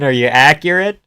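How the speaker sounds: normal talking voice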